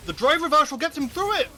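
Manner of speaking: loud, high hype shout; excited shout. Real, not acted. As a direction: yell it out in disbelief. Caster reacting to big play.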